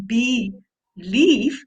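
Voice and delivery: usual speaking voice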